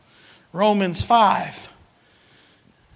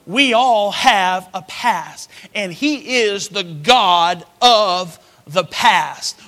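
preaching; 5 dB louder than usual. Acted. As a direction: preach it with rising force. Preaching with force.

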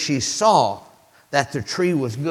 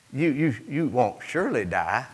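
soft, lower, halting tempter; hesitant serpent; 5 semitones lower, 6 dB quieter than usual. Acted. Serpent tempting the woman, soft and hesitant. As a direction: stammer softly towards a questioning end. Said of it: "the serpent said to the woman".

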